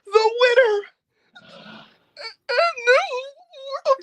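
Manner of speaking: high, drawn-out mock sobbing announcement; badly faked weeping, words stretched into a wail; Samantha Irvin fake crying. Ring announcer calling the title win, fake crying. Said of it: "she did the fakest".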